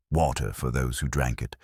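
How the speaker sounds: plain reading voice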